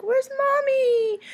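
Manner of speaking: put-on high, slow, whimpering little kid; whiny toddler voice. Mom imitating her toddler waking up without her, high whiny voice. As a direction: whine it like a sleepy toddler calling for mom. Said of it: "he'll wake up and be like".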